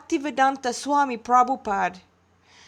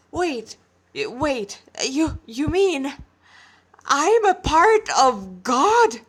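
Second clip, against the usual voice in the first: slows down, ending much louder and higher; stunned boy realizing he's part of God; shocked disbelief; 4 semitones higher, 5 dB louder, slower than usual. Acted. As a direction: stammer then blurt it out slowly.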